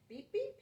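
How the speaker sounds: high happy beep beep; grinning beep. Acted. Truck honks happily splashing in the stream. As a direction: honk with a little grin. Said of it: "he said with a little blue grin".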